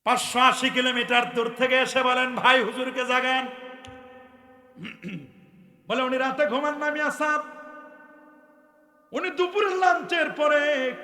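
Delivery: high, loud preacher's cry; fiery preaching. Acted. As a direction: preach it out to the crowd. Preaching waz at full force.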